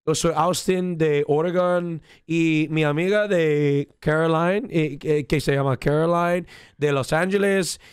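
speaking with a Spanish accent. Shown as a demonstration. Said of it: "this is how I start every Spanish conversation".

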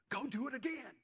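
high-pitched mock buddy voice; egging-on buddy. Buddy eggs his coworker on to turn up store music, high mock urging.

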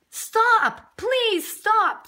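high, loud calling out; storybook woman's pleading cry; urgent plea. A narrator's reading. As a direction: call after a runaway, begging. Woman begs snow dude to stop.

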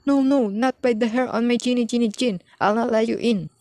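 high squeaky defiant pig voice; squeaky little pig. A narrator's reading. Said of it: "squeaked the third little pig".

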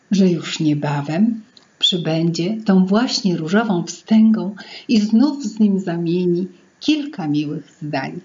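ordinary delivery

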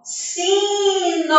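SINO sung out slowly, each syllable held long; drawn-out syllables. Shown as a demonstration. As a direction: stretch both syllables of "Sino", high and ringing. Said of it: "Olha a sonorização da fala".

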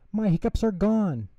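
high, loud and delighted; gleeful. Acted. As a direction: still bursting with joy. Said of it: "shouted B one with glee".

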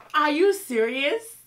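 loud, high, slow disbelief; can't believe what she heard; shocked disbelief. Real, not acted. Reacting to host's reply.

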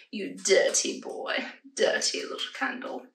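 breathy low whisper to a candle; sultry whisper. Real, not acted. Flirting with a candle, low whisper. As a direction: purr it like a naughty tease. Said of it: "I don't know why I said that like that".

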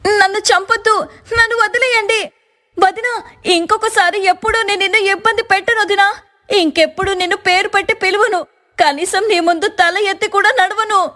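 high, panicked begging for her life; terrified pleading. Acted. Divya begging the knife-wielding sister-in-law in a nightmare, high frightened plea. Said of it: "దివ్య గట్టిగా అరవటం మొదలుపెట్టింది".

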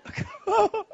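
high, wailing comic sob; mock sobbing. Crying husband waiting for wife's answer, mock sobs. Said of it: "I'm crying".

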